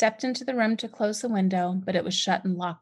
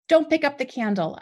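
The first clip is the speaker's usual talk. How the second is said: high, loud yelled warning; 7 semitones higher, 5 dB louder than usual. A narrator's reading. Mother yelling at her son by the candle, loud warning. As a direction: snap a warning at him. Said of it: "I yelled".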